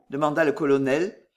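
ordinary delivery

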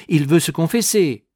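high, loud triumphant claim; eager interruption. A narrator's reading. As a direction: jump in loudly to claim him. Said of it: "interrompit le moine".

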